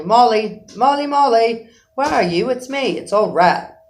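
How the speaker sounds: loud, high, cheerful calls; cheerful calling. A narrator's reading. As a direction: shout cheerfully into the dark wood. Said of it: "calling out cheerfully".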